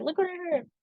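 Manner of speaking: mother pulling workers from work to gossip, high and eager; gossipy mom. Retold or imitated. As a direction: pull them in with juicy gossip. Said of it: "be like".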